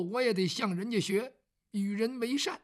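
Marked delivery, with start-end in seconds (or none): none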